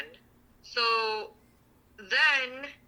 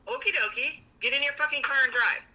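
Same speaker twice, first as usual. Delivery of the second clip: high, mocking okie dokie; sarcastic retort; 6 semitones higher than usual. Retelling what she told her partner, sarcastic and high. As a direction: sneer it back at him.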